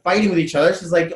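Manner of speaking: ordinary delivery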